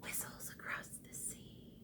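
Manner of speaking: barely-there hushed whisper; soft whisper. Shown as a demonstration. Teacher leading kids in a soft whisper. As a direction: whisper it very softly. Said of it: "Say it softly with me".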